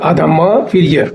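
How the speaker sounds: ordinary talk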